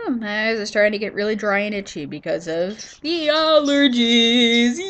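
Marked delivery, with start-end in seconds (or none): none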